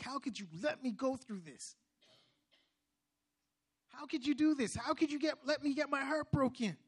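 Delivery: high-pitched, hurt reproach, re-enacted; mad. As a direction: reproach God as if reliving the anger. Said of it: "And I was mad".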